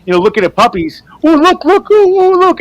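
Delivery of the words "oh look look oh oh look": high, loud, gushing look look; puppy-like excitement. Retold or imitated. Boyfriend at the art show, pointing, giddy and high. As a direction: squeal it like a kid seeing puppies. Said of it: "like, all excited, like the puppy".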